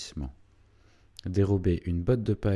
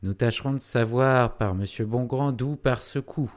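ordinary delivery

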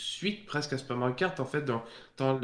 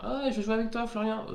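high, eager imitated relative voice; mock family member; 10 semitones higher than usual. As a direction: chirp it like an excited relative.

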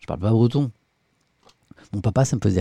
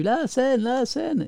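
much higher, chanting like impatient viewers; high-pitched mock chant of complaining viewers; mock viewers yelling; 14 semitones higher, much slower than usual. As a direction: imitate the chat nagging him. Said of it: "vous me criez dessus".

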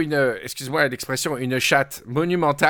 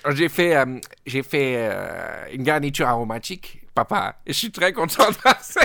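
put-on posh Creole accent. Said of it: "un accent du seizième et un peu de créole".

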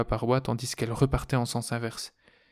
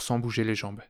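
ordinary delivery

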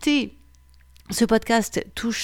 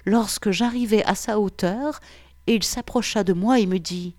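erotic story narration; 3 semitones lower than usual. A narrator's reading. Podcast host reading a listener's erotic story, intimate narration. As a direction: draw listeners in, sensual and unhurried.

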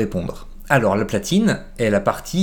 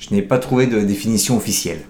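ordinary talk, nothing marked

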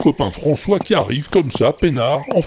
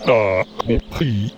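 drawn-out slow speech; much slower than usual. Acted. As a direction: stretch every word, very slow. Slow talk continues.

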